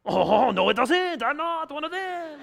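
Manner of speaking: high-pitched, hammed-up protest; loud, climbing mock-frantic voice; panicked denial. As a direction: squeal the denial in comic panic. Peter's panicked denial, acted comically.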